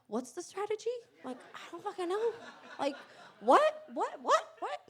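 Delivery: high, hushed, rising disbelief; baffled. Retold or imitated. Speaker reacts inwardly to the VP's approval, baffled.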